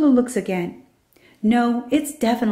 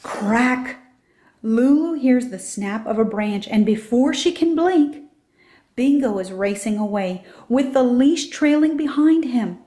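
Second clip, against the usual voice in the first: ordinary delivery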